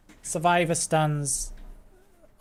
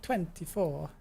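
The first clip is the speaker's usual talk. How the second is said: a light mock Italian lilt on twenty-four; Italian accent; 8 dB quieter, slower than usual. Streamer teasing a viewer's name in mock Italian accent. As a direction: say "Twenty-four" like an Italian would, half joking. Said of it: "do it in, like, an Italian accent?".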